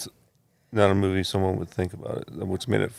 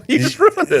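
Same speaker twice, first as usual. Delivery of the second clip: high-pitched laughing complaint; laughing protest; 7 dB louder than usual. Real, not acted. Friend guessed the movie too fast, protesting through laughter. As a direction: laugh it out in a squeak.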